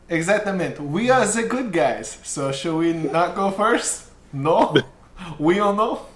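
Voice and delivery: put-on high, slow Spanish-accented voice; playful Spanish accent, drawn out. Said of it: "Hope says".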